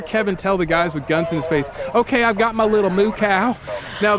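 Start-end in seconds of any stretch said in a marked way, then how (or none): 1.9-3.6 tag along dog owner. Acted. Voicing owner to armed cops.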